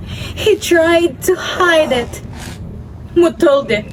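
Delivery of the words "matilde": low, careful husband's voice. A narrator's reading. Husband breaking news to his wife, careful. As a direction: address her carefully, pitched down. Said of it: "he said carefully".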